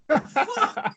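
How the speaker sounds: sudden high loud swear; startled shout. Real, not acted. Swearing as the cat crashes about, startled shout. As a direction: blurt it out in shock.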